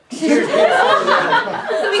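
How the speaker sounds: high, slow, overwrought wail; mock weepy reading; hammed-up tearful narration. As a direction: overdo the tragedy. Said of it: "Does someone wanna grab some water?".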